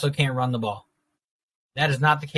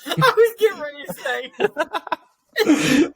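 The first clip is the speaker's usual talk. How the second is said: laughing; 14 semitones higher, 4 dB louder, much slower than usual. Real, not acted.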